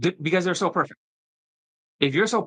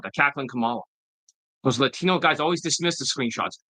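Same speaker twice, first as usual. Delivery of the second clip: ordinary talk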